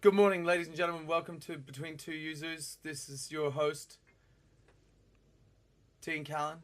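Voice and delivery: announcer read, fast then slowing down; show host intro. Acted. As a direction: open the show like a TV presenter. Hosting a mock show intro.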